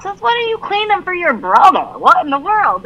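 high, loud teasing disbelief; mock amazed. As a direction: tease her like you can't believe it. Mom teasing daughter, mock amazed.

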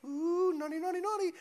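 high, sing-song tut-tutting of a judgy churchgoer; mock scolding. Judgy churchgoer tutting at someone who gets real, mock scolding. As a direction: wag a finger in a high teasing voice. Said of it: "you're like".

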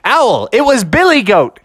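loud, high exclamation; calling out. Acted. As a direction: call it out to the owl. Bears call out to owl.

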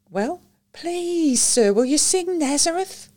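put-on high, wheedling child's voice; pleading little girl. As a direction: coax him sweetly into singing. Child begging for a song.

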